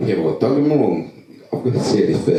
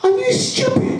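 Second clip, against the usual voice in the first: higher and higher, breaking into a laugh; pitched-up retold sneer with a laugh; mocking disbelief; 4 dB louder than usual. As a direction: scoff at the question as absurd. Retold clash with counsellor.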